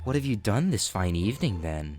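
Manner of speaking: low, soft sultry teacher voice; creepy teacher voice. Acted. Vlogger reads teacher's creepy message aloud, low and soft.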